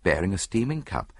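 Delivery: plain reading voice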